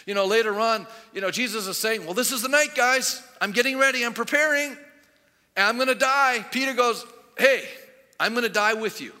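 loud, high-pitched sermon storytelling; full-force preaching. Acted. Preacher retelling Peter's vow to the congregation, full-force preaching.

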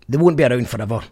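ordinary talk, nothing marked

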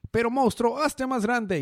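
high squeaky villain command. Retold or imitated. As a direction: shriek it like Rita. Rita villain command quote. (voz aguda de villana)

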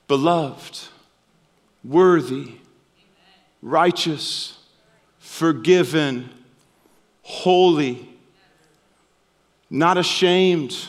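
slow, spaced words of blessing; tender declaration. Real, not acted. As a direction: speak each name over them gently. Pastor declares believers' identity.

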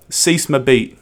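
"Suce ma bite" with flat English vowels; a Brit mangling "Suce ma bite"; English accent on French. Shown as a demonstration. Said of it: "here's an English person doing French".

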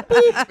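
squeaky high loud boop; scanner beep. Retold or imitated. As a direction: pop the beep sound sharply. Imitating scanner beep again.

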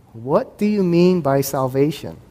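ordinary delivery

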